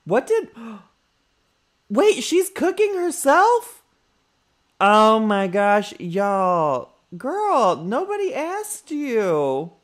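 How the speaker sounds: high, loud indignant outburst at the Sim; outraged shout. Real, not acted. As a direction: yell at her in full disbelief.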